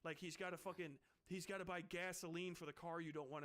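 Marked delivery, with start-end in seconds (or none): none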